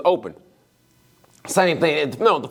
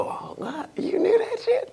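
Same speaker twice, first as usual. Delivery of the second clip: amazed whisper; 14 semitones higher, 5 dB quieter, slower than usual. Retold or imitated. Tow guy amazed at lyric.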